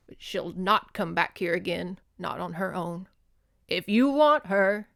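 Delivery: reading a novel passage aloud with character voices; story narration. Acted. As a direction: read it like a dramatic novel. Reads novel excerpt aloud.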